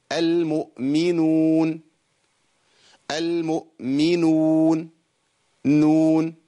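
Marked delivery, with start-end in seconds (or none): 0.1-4.9 المؤمنون with the uu drawn out; long waw madd. Shown as a demonstration. Teacher demonstrating waw vowel length. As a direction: stretch the uu of المؤمنون slowly. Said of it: "عايزين لمد الواو".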